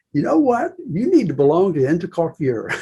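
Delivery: Georgia accent mimicked, starting high; imitated Georgia accent. Mentor advising a young salon owner, imitated Georgia accent. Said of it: "He got the Georgia accent".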